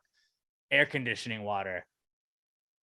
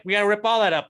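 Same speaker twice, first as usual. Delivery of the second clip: urgent call; 9 semitones higher, 9 dB louder, faster than usual. Retold or imitated. Quoting the Harvey flood response.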